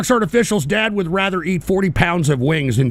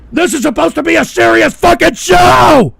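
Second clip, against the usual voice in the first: high, loud exasperated yell; outraged shout; 9 semitones higher, 7 dB louder than usual. Real, not acted. Host defending his serious show, outraged shout. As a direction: bellow it, stretching the last word.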